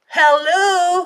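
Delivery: high, loud, drawn-out hello; can you believe it, hello; incredulous hello. Real, not acted. Profiler mocking the athlete's slip to the host, incredulous.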